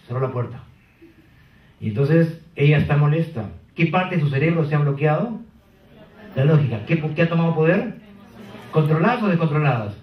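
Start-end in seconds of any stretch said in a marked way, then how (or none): none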